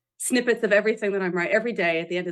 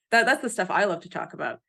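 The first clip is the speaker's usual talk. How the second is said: ordinary delivery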